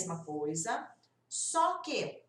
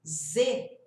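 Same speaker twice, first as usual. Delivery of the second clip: ordinary talk, nothing marked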